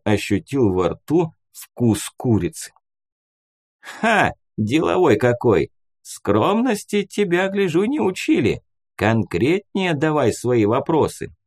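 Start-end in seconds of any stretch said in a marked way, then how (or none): 3.8-11.3 put-on scoffing old ghost. A narrator's reading. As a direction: scoff at the cheeky young man.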